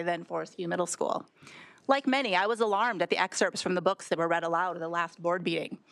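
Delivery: ordinary talk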